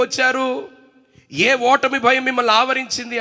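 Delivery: fiery preaching. Acted. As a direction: hammer the questions home from the pulpit. Preacher questioning congregation loudly. (బోధకుడు సంఘాన్ని గట్టిగా ప్రశ్నిస్తూ ఉద్రేకంగా ప్రసంగం)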